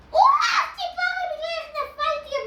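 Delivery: very high, loud startled cry; surprised wow. Real, not acted. Reacting to falling in game, surprised yelp. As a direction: yelp at the fall.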